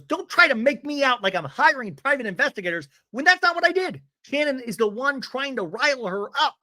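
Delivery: high, loud mock indignation; defensive rant. Tamara in talking head denies hiring investigators, high indignant rant. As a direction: protest hotly, imitating an accused housewife.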